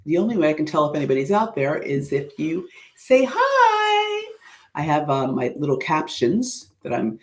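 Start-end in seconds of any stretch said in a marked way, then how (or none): 3.0-4.3 high, drawn-out singsong call. Real, not acted. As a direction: call out to an unseen audience. Calling out to unseen online audience.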